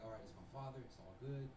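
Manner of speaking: soft, low self-soothing murmur; calm yourself down quietly, phrase by phrase; hushed pep talk to himself; little mantras. Acted. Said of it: "just little mantras like".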